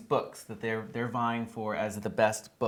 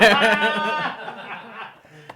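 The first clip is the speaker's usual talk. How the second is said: loud high laughter; 9 semitones higher, 7 dB louder than usual. Real, not acted. Blooper after flubbed line.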